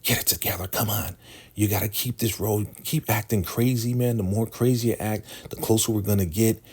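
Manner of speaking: high-pitched, hurried urging; mock urgent pep talk. Ruth telling Lacey to keep acting, urgent high voice.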